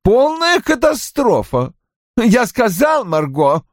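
high-pitched, exasperated exclamation; querulous, vexed character voice. A narrator's reading. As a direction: lament the disaster, pitched well up. Roy exclaims about the disaster.

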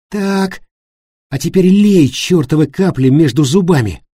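high, forceful order; urgent command. A narrator's reading. David holding Thomas's mouth open, ordering urgently. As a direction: order it impatiently under pressure.

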